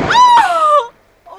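high, loud scream of horror; anguished scream. Acted. Wife watches Paul dive into the sea, screaming. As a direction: scream as he jumps.

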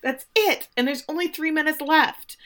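high-pitched urgent retelling; excited coach. Retold or imitated. Coach with three minutes left, high excited voice.

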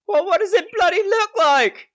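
put-on high-pitched, exasperated boy's voice; indignant farm boy. Farm boy answering strangers at his burned farm, high and indignant. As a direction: snap back as an indignant youngster. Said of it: "He's yelling at me".

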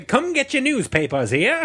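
put-on old-time New York accent. Shown as a demonstration. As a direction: hawk papers like an old-timey New Yorker. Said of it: "kinda like New York almost".

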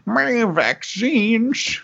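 high, drawn-out, dreamy villain voice; mock Bill Gates voice. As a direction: stretch it out slowly, high and smug. Mocking Bill Gates persona.

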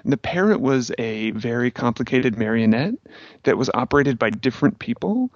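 ordinary talk, nothing marked

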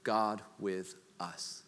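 slow, soft, reverent God with us; a hushed, slow declaration; solemn emphasis. Acted. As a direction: let each word land slowly and quietly. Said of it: "He says this".

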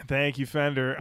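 usual speaking voice

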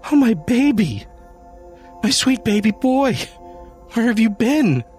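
put-on a mother finding her lost child; overjoyed exclamation. A narrator's reading. Janie to the kitten, ecstatic high cry. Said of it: "she exclaimed".

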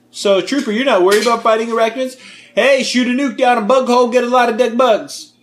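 high, hyped-up trooper exchange; eager high movie-quote voice; movie soldier quote. Acted. Acting out lines from the movie.

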